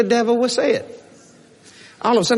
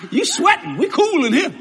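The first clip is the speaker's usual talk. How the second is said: a higher, teasing brag; playful taunt; 8 semitones higher than usual. Acted. The Hebrew children teasing Nebuchadnezzar from the fire, cocky. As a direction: tease the king, light and cocky.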